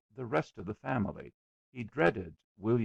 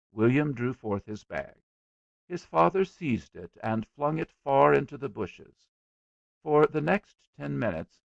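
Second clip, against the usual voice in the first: ordinary delivery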